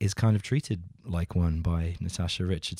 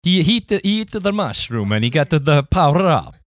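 much higher, louder hammed-up Italian accent; mock Italian accent; 8 semitones higher, 10 dB louder than usual. Co-host joins the Italian accent joke, loud and hammed-up. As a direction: belt it like a cartoon plumber. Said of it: "that Italian accent".

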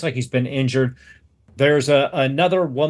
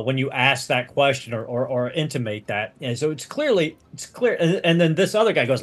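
normal talking voice

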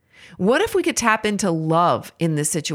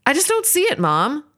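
lightly voiced daughter, pitched high then dropping; a light, high imitation of a daughter; daughter's protest; 8 semitones higher than usual. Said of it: "when my daughter says".

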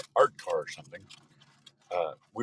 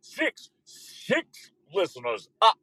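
slow, high vampire count voice; hammed-up Transylvanian announcer; Count Dracula; 6 semitones higher, 5 dB louder, slower than usual. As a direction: count it out like a cartoon vampire, laughing ah-ah-ah. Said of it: "I feel like Count Dracula".

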